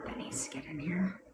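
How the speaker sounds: whispered aside to herself; real whispered aside. Real, not acted. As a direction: breathe it under her voice. Aside about desk items.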